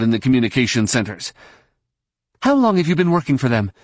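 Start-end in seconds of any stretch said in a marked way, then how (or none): 2.4-3.7 high, fast, sharp demand. Acted. Jaina confronting the Hutt spy, fast sharp demand. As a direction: fire the accusation at him quickly. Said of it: "she demanded".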